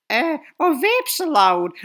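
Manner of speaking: high, slow, loud question; mock high-pitched timid pupil asking; mimicked girl's voice. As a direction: ask it in a put-on girly voice. Imitates girl asking instructor.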